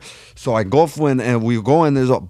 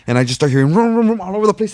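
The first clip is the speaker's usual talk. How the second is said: engine vroom; 8 semitones higher, 4 dB louder, faster than usual. Retold or imitated. Imitating cars revving.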